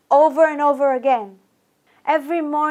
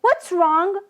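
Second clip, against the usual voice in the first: yell; 6 semitones higher, slower than usual. Retold or imitated. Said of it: "So I yell".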